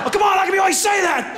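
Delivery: high-pitched exasperated shout; screaming match. Acted. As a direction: shout back at your wife in an argument. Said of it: "We've had some screaming matches".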